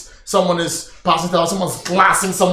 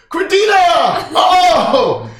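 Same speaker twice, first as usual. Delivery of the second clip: excited greeting; 14 semitones higher, 6 dB louder, much slower than usual. Acted. Barkeep greets old friend.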